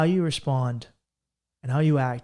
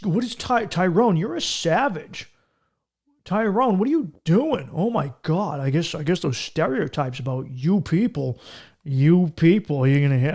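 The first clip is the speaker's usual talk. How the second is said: exaggerated suburban pearl-clutching, pitch jumping up; 3 dB louder than usual.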